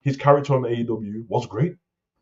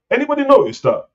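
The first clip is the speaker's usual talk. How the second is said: excited question; 5 dB louder than usual. Real, not acted. Host calls out to viewers.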